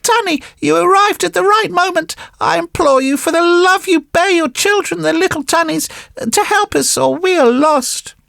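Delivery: high-pitched little puppet voice, imploring; pleading puppet boy. Acted. Puppet boy begs the tunny for help, high and pleading.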